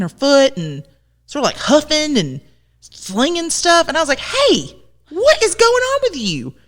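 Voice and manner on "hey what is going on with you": much higher, mock-aggressive demand. Shop owner to huffing customer, high mock-aggressive demand. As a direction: confront the customer in a lifted, pushy voice. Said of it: "I was really aggressive about it".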